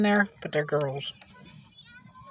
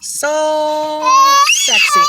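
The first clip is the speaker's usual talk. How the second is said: swooning over a hot guy; 8 semitones higher, 9 dB louder, much slower than usual. Pretend. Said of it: "there's this one".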